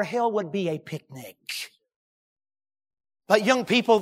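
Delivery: ordinary talk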